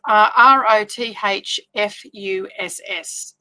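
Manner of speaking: R O T H F U S S, each letter slow and separate; spelled out letter by letter. Shown as a demonstration. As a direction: spell R O T H F U S S out slowly. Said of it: "I was spelling it wrong".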